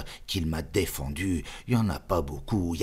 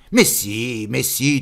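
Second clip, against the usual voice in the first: high, loud insisting; insistent; 7 semitones higher, 9 dB louder than usual. Acted. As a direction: insist he must remember. Insisting the friend remembers. (insistance vive, aiguë et forte)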